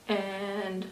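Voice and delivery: usual speaking voice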